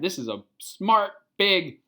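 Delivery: ordinary delivery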